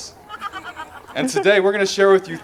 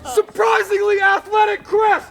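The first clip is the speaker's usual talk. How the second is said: announcer shout; 13 semitones higher, slower than usual. Acted. Announcing toast name, shouting.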